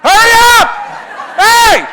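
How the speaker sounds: very high, very loud heckle; impatient kids' yell. Retold or imitated. Kids in line heckling the frozen girl, impatient yell. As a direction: shriek it like kids waiting behind. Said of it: "what are the kids in line saying".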